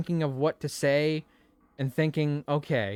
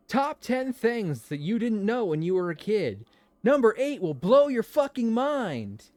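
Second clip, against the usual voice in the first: clickbait video narrator; 8 semitones higher, 5 dB louder than usual. Pretend. Mock clickbait listicle headline.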